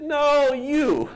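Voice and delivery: very high, loud comic squirm; awkward correction. Retold or imitated. Cartoonist telling his colleague the strip means her, awkward.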